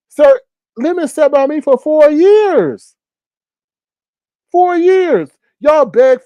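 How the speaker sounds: high, loud, slow exasperated protest; indignant. Real, not acted. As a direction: set the record straight, fed up. Heated rebuttal to chat claims.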